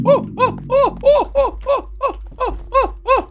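rapid high oh oh oh in fright; startled repeated high cries; panicked high yelps. Real, not acted. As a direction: squeal it out in a panic. Panicking at jump scare.

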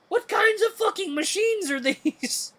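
very high squealed disbelief; incredulous outburst. Real, not acted. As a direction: exclaim in high-pitched, drawn-out horror.

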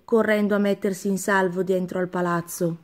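usual speaking voice

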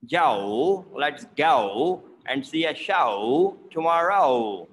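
"Go", "show" and "tomorrow" drawn out as ah-oh; "show" as a long, dipping "sha-ow"; exaggerated O diphthong. Shown as a demonstration. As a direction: slowly swoop every O from open to rounded in "go", "show", "tomorrow". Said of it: "like, a O sound".

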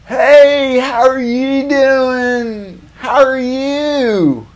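high, slow, sugary greeting; hammed-up fake niceness, high and drawn out; fake-nice dentist. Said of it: "he puts on this, like, front of, like, trying to be nice".

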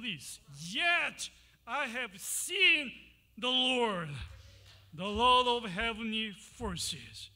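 loud, anguished cry, slowing on yet I have seen; ruined prophet. Acted. Isaiah crying out before the holy God, loud and anguished. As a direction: cry out overwhelmed by God's presence.

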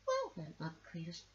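ordinary delivery

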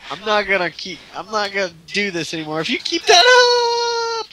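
high wailing fake sob, rising to a shout; mock crying. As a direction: whine it like a fake tantrum.

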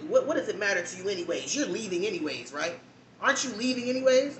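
rising, exasperated jab; mock irritated coworker. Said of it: "snaps at me".

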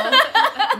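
very high, loud wow with laughter; playful exclamation. Pretend. Echoing friend's wow, high loud laughing squeal.

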